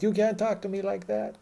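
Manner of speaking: high-pitched indignant imitation; mock Eastern European protester. Eastern European guest arguing with the bouncer, mock indignant. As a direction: put on a high voice, mimicking an angry guy. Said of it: "Eastern European dudes being like".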